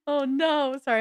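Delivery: high, loud amused outburst easing off; amused laughing. Real, not acted. Host reacting to the stream chat, laughing. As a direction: break out of a laugh to apologize. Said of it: "I'm laughing 'cause I just saw".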